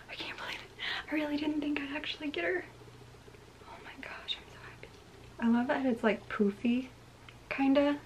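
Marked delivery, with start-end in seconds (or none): 0.1-4.9 soft, breathless happy whisper; happy disbelief. Real, not acted. Collector who never expected to get her, happy whisper. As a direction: whisper it in stunned joy.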